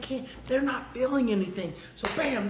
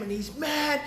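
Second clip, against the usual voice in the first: normal talking voice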